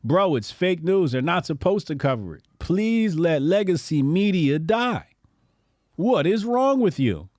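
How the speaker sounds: ordinary talk, nothing marked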